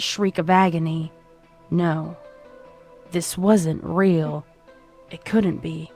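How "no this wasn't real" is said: ordinary delivery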